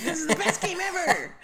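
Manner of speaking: high, laughing victory squeal; mock cheering. Gamer cheering right after a kill, mock triumph. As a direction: celebrate in an over-the-top high voice. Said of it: "then you cheer".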